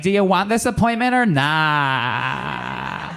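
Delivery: slow, drawn-out mock imitation; mock fiancée voice. Defending his phone impersonation to his fiancée, slow mock voice. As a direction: drag out the last word in the put-on voice. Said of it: "I don't sound like that".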